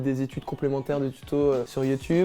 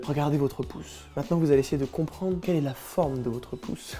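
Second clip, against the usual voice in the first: a hint of a hypnotist guiding the patient; hushed mock hypnotist; 6 dB quieter than usual. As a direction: lull him like a stage hypnotist.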